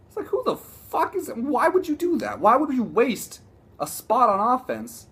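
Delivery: high-pitched, incredulous rant; exasperated outburst. Real, not acted. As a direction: demand an answer in high-pitched disbelief. Ranting about an old play call.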